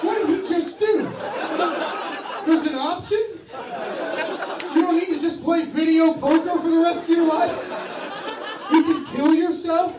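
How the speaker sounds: high, loud, amazed voice; squealing amazed customers; baffled onlookers. Acted. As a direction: gasp it out like stunned gamblers. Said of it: "And they're like".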